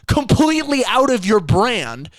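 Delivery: high, exasperated questioning; incredulous rant. Real, not acted. Host scolding the brand for dropping gym, incredulous.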